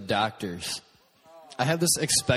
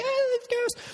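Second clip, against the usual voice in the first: very high panicked squeal; mock freaking out; slower than usual. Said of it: "freaking out".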